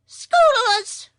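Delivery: high, loud creature shriek; piercing high shout; sharp, shrill yell. A narrator's reading. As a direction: yell it like a chorus of hostile creatures. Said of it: "yelled in a chorus".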